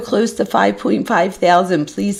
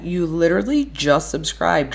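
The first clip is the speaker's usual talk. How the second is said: usual speaking voice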